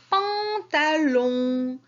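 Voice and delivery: "pantalon" very slowly in three syllables; teacher sounding out "pantalon" for children. Shown as a demonstration. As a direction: stretch "pan", "ta", then a long "lon". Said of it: "la syllabe que j'entends".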